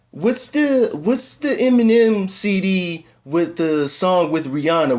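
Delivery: high, drawn-out mock mom voice; imitating mom. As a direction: play mom asking an innocent question. Acts out mom's phone question.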